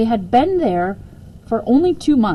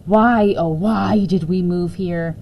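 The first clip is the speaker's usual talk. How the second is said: slow, weary lament; groaning; slower than usual. Acted. Mother groaning about the new house, slow. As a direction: moan it like a lost cause. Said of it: "she groaned".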